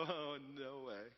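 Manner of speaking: low, very slow drawn-out dismissal; mock disbelief. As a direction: stretch it out, appalled onlooker act. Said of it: "look at those people and go".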